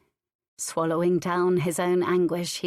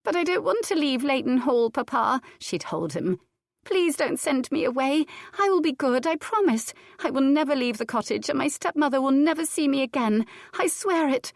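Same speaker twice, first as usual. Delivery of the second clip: put-on high, tearful child begging; crying little girl; 7 semitones higher than usual. Girl begs her father not to send her away, tearful and high. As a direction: plead through tears like a frightened child. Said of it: "Cicely began to cry".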